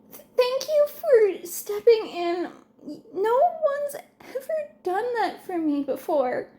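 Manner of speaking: put-on a shy kid thanking his idol; shy grateful boy. Boy thanks hero, stammering.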